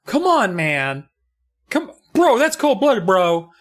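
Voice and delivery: put-on high, loud old man pleading. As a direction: plead loudly in a creaky old voice. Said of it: "it's like".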